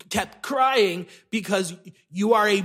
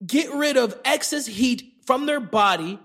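ordinary talk, nothing marked

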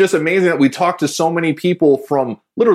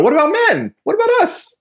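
high mock outrage, playfully pleading for the men; a playful whine, as if the men were left out; mock indignant protest. Host protesting that men are left out of the Red event, mock indignant.

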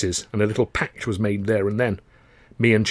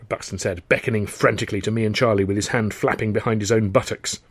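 usual speaking voice

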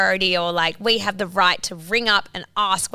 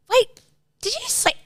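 shocked disbelief. Retold or imitated. Re-enacting her shock at her boyfriend, high and startled. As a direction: jump up in pitch as it suddenly dawns on you.

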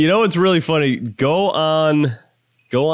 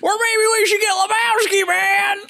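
Lebowski voice. Pretend. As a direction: play the Dude way up high. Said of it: "That's the Lebowski we're going for".

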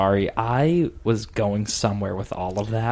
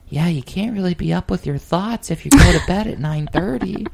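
higher and louder mock voice; mocking high-pitched guy; 7 semitones higher, 5 dB louder than usual. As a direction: say it up high, earnest and a bit offended. Retelling Scott's high voice.

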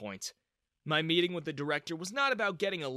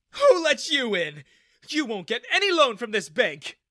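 high, loud outraged shriek; 9 semitones higher, 10 dB louder than usual. A narrator's reading. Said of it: "he shrieked".

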